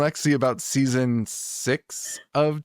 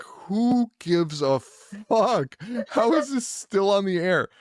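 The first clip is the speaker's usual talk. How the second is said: slow, higher, incredulous scoff; mock outrage, pitched up and slow; exasperated scoff; 7 semitones higher, slower than usual. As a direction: drag out the disbelief at the show. Mocking an endless TV show.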